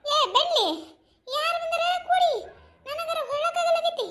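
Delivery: high, drawn-out querulous call; cranky demand. Acted. Bedridden woman who cannot turn asks who came, cranky. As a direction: call out crossly, high and slow.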